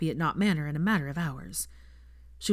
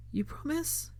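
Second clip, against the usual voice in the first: small, high, tearful question; a girl who has just stopped crying asks quietly; sniffling; 10 semitones higher, 8 dB quieter, slower than usual. A narrator's reading. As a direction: ask through tears, high and fragile. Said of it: "she asked, sniffling".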